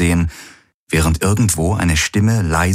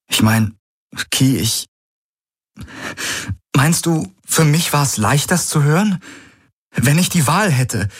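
he holds steady while sorrow seeps through; calm but grieving; 9 semitones higher than usual. A narrator's reading. Galen pleads with Ki, calm but full of sorrow. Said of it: "Seine Stimme war ruhig, aber voller Kummer".

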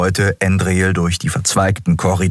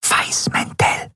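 slow, hushed whisper; whispered warning; 4 dB quieter, slower than usual. A narrator's reading. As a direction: whisper the danger to the group. Warns companions of nearby enemies. (warnend flüstern)

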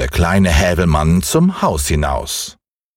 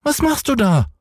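hohe, quengelnde Kinderstimme; kleiner Junge schreit. Acted. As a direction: fordernd wie ein ungeduldiges Kind. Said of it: "rief der kleine Häwelmann hinauf". (hoch und fordernd gerufen)

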